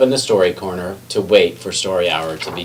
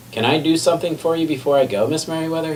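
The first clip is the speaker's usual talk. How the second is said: higher, gentle offer; kind offer; 8 semitones higher, faster than usual. A narrator's reading. McBee kindly offering help to Miss Merriweather, gentle and higher. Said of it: "he asked her".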